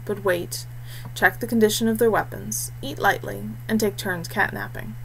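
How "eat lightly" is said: plain reading voice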